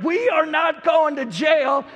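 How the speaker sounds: dad shouting back at his son; angry shout. Retold or imitated. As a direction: snap back at the kid. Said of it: "And I'm getting angry".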